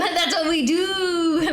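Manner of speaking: slow, drawn-out catchphrase. Acted. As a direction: echo it slowly like a motto. Catchphrase echoed.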